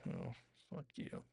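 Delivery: low, very soft mutter; muttered resentment. Retold or imitated. Her face reacting to his remark, muttered. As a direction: mutter it under your breath.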